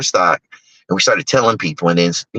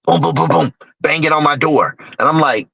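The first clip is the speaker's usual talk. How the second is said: usual speaking voice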